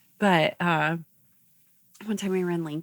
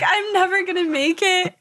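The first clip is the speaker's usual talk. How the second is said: mock despair through laughter, much higher and louder; laughing; 12 semitones higher, 5 dB louder than usual. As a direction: wail it while cracking up. Retelling panicked bad trip laughing.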